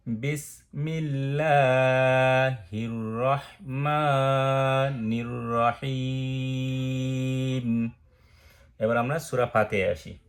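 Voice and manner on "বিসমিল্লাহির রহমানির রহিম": slow, drawn-out Quranic chanting; slow recitation. Shown as a demonstration. Teacher reciting the opening phrase slowly for students. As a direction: intone each syllable long and melodic. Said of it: "তাহলে আমি আর করে বলি".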